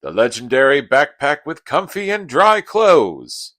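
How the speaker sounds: loud, grand proclamation; mock-heroic announcement. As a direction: announce it like a legendary treasure. Mock-grand reveal of dry clothes.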